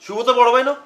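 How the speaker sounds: high-pitched indignant scolding; stern reprimand. Acted. Elder demands respect for an older brother, sternly scolding.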